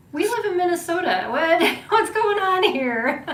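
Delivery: playing up her own baffled surprise with a laugh; incredulous laughing mock. Mother retells reacting to son's accent.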